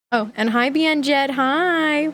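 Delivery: ordinary talk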